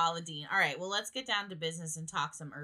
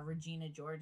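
usual speaking voice

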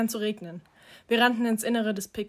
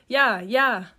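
louder, gleeful narrated cry; laughing exclamation, no laugh; 2 semitones higher, 7 dB louder than usual. A narrator's reading. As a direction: call it out as if amused. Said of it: "Dante lachte". (ausgelassener Ausruf)

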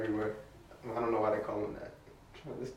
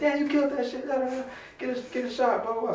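very high, softened mock fan voice; imitated hype; 13 semitones higher, 5 dB quieter than usual. Club guests praising the performer, imitated hype. Retelling club crowd hyping him up.

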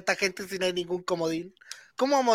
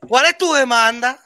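mock negotiator; 4 semitones higher, 9 dB louder than usual.

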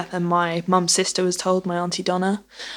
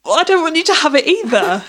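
high, louder, laughing through the words; answering mum's joke while laughing, pitched up; 9 semitones higher, 4 dB louder than usual. Retold or imitated. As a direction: giggle it out in disbelief.

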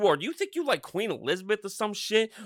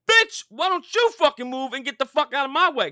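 loud, higher furious yell; angry shout; 8 semitones higher, 8 dB louder than usual. Real, not acted. Ranting at a driver who won't move, shouting angrily. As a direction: blow up at them, full volume.

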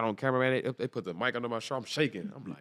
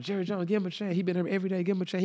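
imitating fans in a much higher voice; pleading fans; 9 semitones higher than usual. Fans urging the team owner for him, high imitated plea. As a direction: raise the pitch to mimic a pleading crowd.

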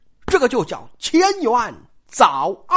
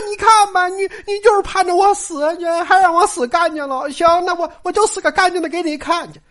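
put-on much higher and louder, a girl's tearful outburst; angry Daiyu; 9 semitones higher, 4 dB louder than usual. Daiyu lashing out at Baoyu, high and loud. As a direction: flare up and throw it back at him. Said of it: "但是说".

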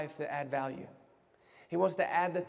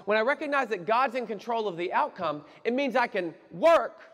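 raised, impassioned preacher cadence; forceful preaching; 7 semitones higher, 5 dB louder than usual. Real, not acted. Preacher urging church to work without worry, forceful preaching. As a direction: build it high and punch the key words.

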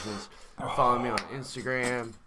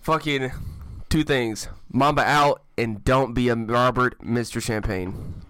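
loud shout-out; 2 semitones higher, 9 dB louder, slower than usual. Real, not acted.

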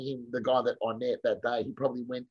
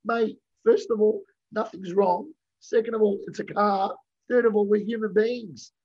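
raised, firm point-by-point telling-off; exasperated lecture; 7 semitones higher, 3 dB louder than usual. Retold or imitated. To the yelling driver after a bump, exasperated and raised. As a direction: list it off like you're talking sense into him. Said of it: "I'm like".

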